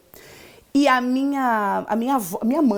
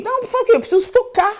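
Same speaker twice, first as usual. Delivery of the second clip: high-pitched imitation of a flustered pupil; pleading student; 11 semitones higher, 4 dB louder, faster than usual. Teacher imitating student explaining herself, high earnest plea. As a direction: plead innocently, far higher than usual.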